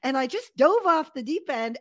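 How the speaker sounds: delighted, animated storytelling; retold exhilaration. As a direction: revel in the thrill of the ride. Acts thrill on the water slide.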